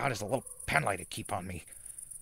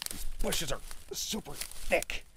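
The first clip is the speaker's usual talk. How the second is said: straining whisper; 8 semitones higher, slower than usual. Acted. Pushing through bushes.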